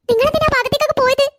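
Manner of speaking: high-pitched, panicked reproach; alarmed scolding. Acted. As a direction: question the children in rising panic. Alarmed scolding after the accident. (പരിഭ്രാന്തിയോടെ)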